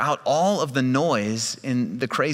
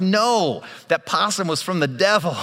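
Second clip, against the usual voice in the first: higher, louder indignant retort; emphatic protest; 8 semitones higher, 3 dB louder than usual. Retold or imitated. Husband disputing his wife's take on the possum, indignant. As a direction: push back hard, comically indignant.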